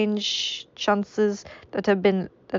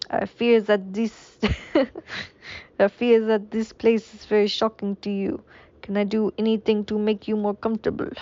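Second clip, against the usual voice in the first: a light, hammed-up French accent; mock French doctor speaking gently. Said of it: "this is French anymore".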